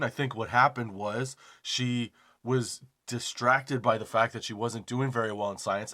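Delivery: ordinary talk, nothing marked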